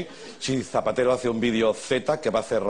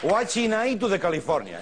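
higher-pitched mimicry of garbled English lyrics; imitating the singer; 9 semitones higher than usual. Host mimics a Hotel California contestant, mock garbled English. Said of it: "Lo dice él".

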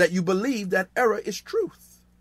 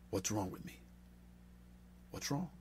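very soft, searching plea to God; hushed self-questioning in acted prayer; quiet prayer; 7 semitones lower, 21 dB quieter than usual. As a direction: ask it privately, barely voiced. Acts out private prayer at altar.

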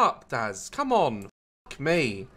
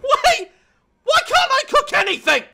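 high, loud laughing yell; exasperated shout; 4 dB louder than usual. Real, not acted. Gamer failing to cook, high laughing shout.

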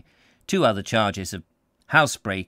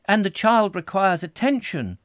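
a hint of a brisk nurse, higher and firmer; decisively; 8 semitones higher, 4 dB louder than usual. A narrator's reading. Nurse Stedge dismisses the canon, decisive and higher. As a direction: firmly close the matter.

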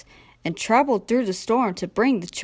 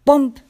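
sudden loud, higher thump word; imitated bump; 5 semitones higher, 9 dB louder than usual. A narrator's reading. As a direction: punch it out like a thud. Narrator imitates a sudden thud.

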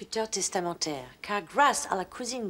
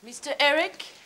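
an English lady's French; English accent; 5 semitones higher than usual. Acted. English visitor asking for Eric, in an English accent.

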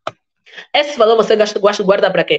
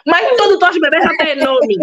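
ordinary delivery

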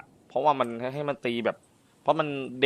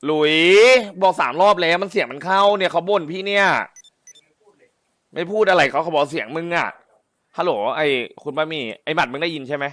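higher and louder, fed-up complaint; frustrated boss shouting into a silent radio; exasperated calling out; 5 semitones higher, 8 dB louder than usual. Real, not acted. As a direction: call out to the radio, fed up nobody answers. Frustrated, nobody answers on radio.

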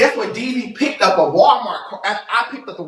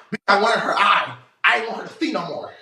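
usual speaking voice

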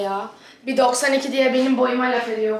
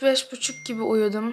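ordinary talk, nothing marked

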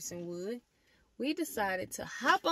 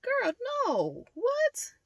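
shocked disbelief; 9 semitones higher than usual. Retold or imitated. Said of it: "And I was like".

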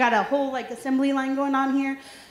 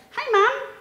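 imitating her little son asking about the cake; toddler son; 2 semitones higher than usual. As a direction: play a two-year-old asking sweetly. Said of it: "he came up and he said".

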